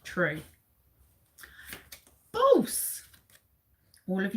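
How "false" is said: high, loud answer shout; dramatic reveal. Real, not acted. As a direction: announce it like a game-show reveal. Quiz host reveals the answer.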